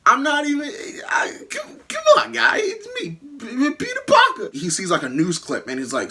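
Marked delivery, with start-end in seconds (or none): 0.0-4.5 high, stammering panicked plea with a cracking shout; squeaky, breaking voice pleading innocence; panicked denial. Retold or imitated. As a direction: beg frantically that it isn't you. Peter panics pleading he's not Spider-Man.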